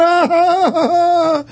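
high, long mock laugh. Host ridiculing the world government denial, mocking laugh.

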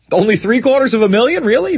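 high-pitched sarcastic disbelief; mock amazement, voice jumping up; mock incredulous. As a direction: question it with rising, feigned shock. Sarcastic disbelief at predicted death toll.

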